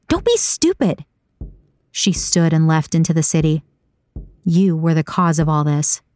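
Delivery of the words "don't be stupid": very high-pitched, exasperated parting shot; high, heated character voice; angry rebuke. A narrator's reading. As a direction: scold them as you leave, voice soaring.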